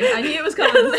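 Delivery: high, laughing voice; real laughter breaking through. Real, not acted. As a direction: burst out laughing as you say it. Laughing reaction to the reading.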